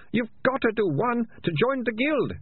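high, pleading whine; moaning. A narrator's reading. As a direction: wail the excuse in a very high voice. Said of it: "Ratcatcher two moaned".

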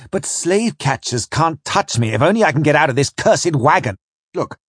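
high, urgent pleading; desperate. Acted. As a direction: argue frantically for a way out. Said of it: "I went on desperately".

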